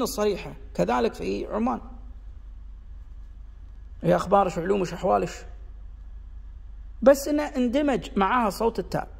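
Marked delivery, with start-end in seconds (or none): 4.0-5.3 final kaf as plain sh in أخبارش علومش أحوالش; shinshina. Shown as a demonstration. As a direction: end each word on a clear sh. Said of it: "إن في شنشنة".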